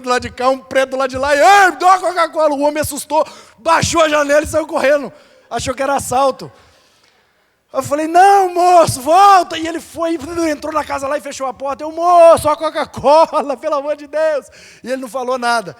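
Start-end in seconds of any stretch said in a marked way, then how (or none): 1.4-2.5 higher, louder call across the yard; retold shout. Jumping out of the car at midnight, shouting to the roadside shopkeeper. 8.1-9.6 high, loud call to someone running off; shouting after him. Retold or imitated. Calling the frightened shopkeeper back, loud urgent shout. 11.9-14.5 loud pleading call. Retold or imitated. Begging shopkeeper behind closed door.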